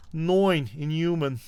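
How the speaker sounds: "nine" said as "noin", New Yorker style. Shown as a demonstration. Host savours his favorite New York pronunciation. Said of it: "My favorite is".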